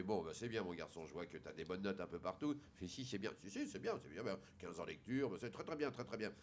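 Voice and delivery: low, soft, measured dad; calmly pleased, murmured over a report; approving father. Acted. Father praising his son's school report, calm approval.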